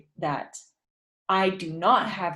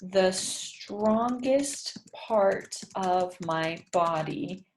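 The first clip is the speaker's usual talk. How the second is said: slow dictation; much slower than usual. Shown as a demonstration. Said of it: "I think I might have to use are".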